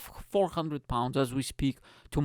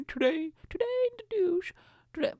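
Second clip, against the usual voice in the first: very high, squeezed newsreader voice; absurdly high-pitched news presenter; mock newsreader. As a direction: squeak out a silly news bulletin. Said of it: "when the person is speaking".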